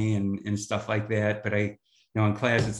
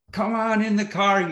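higher, louder cheery host welcome; welcoming host; 9 semitones higher, 3 dB louder than usual. Retold or imitated. John Cronin welcoming a tired traveller, cheerful and higher. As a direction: greet him like the eager host. Host greets guest off plane.

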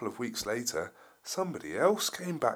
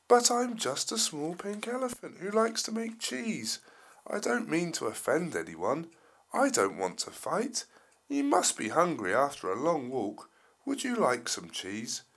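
small elephant voice; 7 semitones higher, 4 dB louder than usual. Acted. Gentle elephant replies.

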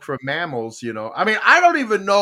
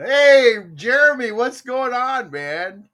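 loud excited greeting; 8 semitones higher, 7 dB louder, slower than usual. Real, not acted. Loudly greeting a newcomer.

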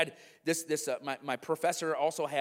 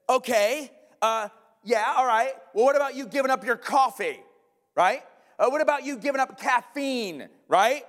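loud, higher, scoffing comeback; defiant challenge; 9 semitones higher, 8 dB louder than usual. Retold or imitated. A student mocks the professor over coffee, loudly defiant. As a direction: throw the challenge back hard. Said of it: "one student stood up and said".

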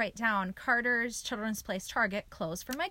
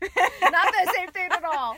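slow, laughing and loud; 7 semitones higher, 8 dB louder, slower than usual. Real, not acted. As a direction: laugh your way through it. Laughing at croutons comparison.

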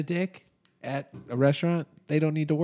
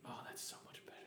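whisper; 26 dB quieter, faster than usual. Real, not acted. Whispered aside into mic.

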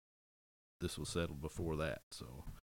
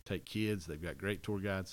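ordinary talk, nothing marked

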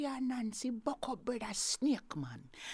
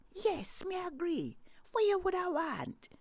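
higher, sly agreeing voice; high Nancy voice; 10 semitones higher than usual. Acted. Nancy agrees to Snake's fee in a higher voice. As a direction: pitch Nancy up, eager to please.

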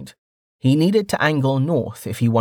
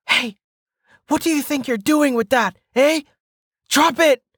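high, angry bandit voice; angry shout; 3 dB louder than usual. A narrator's reading. Bandit challenging a traveller beyond the trees, angry shout. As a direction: yell it high and threatening. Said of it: "came the angry voice".

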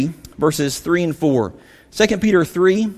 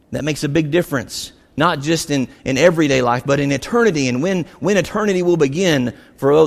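usual speaking voice

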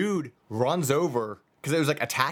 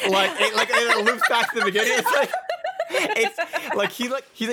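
high, loud talk broken by laughter; cracking up mid-sentence; laughing; 9 semitones higher, 4 dB louder than usual. Real, not acted. Host laughing at the looping video, real laughter. Laughing while describing loop.

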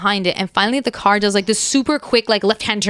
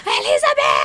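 mock yelling. Dad yelling at a stranger's car, imitated high yell. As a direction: imitate someone calling out, voice shooting way up. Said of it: "like, yelling".

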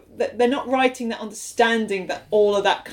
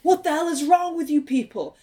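mild mock yell, pitched up; higher-pitched, half-hearted outrage; retold yell; 6 semitones higher than usual. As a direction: imitate a yell without full volume. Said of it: "he yells at this ice guard".

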